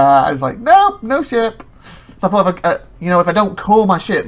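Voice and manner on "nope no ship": high, slow, loud denial; pitched-up dismissive answer; mock blunt refusal. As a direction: deny it with comic finality. Game's answer dashes his hope.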